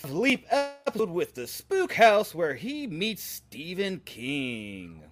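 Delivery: high, loud, drawn-out announcer; slow hype announcer, dropping on the last word. Shown as a demonstration. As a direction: sell it like a show announcer. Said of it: "I always go to announcer voice".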